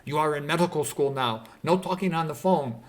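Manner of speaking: loud, emphatic imitation of a Korean mom; strict Korean mother. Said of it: "I had a Korean friend, and his mother was like the opposite".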